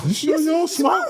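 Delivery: a grandson stunned by his grandma's lungs; amazed question. Retold or imitated. Grandson to grandma after she inhales easily, incredulous. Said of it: "I was like".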